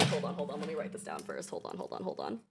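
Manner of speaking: low-key, very soft mumbling; muttered stalling. Acted. Soldier writing a note down first, muttered. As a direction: mutter it while busy writing.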